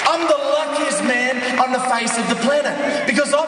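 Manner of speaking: high, loud triumphant testimony; full-force preaching. Real, not acted. Preacher telling the crowd his life was restored, triumphant shouting. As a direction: proclaim each line to the crowd, shouting.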